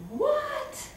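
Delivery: high, shocked what; father stunned to hear she is his daughter, high shocked; startled what. Retold or imitated. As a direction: yelp it in disbelief.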